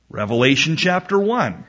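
usual speaking voice

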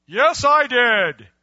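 loud, drawn-out, high proclamation; overconfident boast. Acted. Answering God's question about love, overconfident boast. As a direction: proclaim it grandly, stretching every word.

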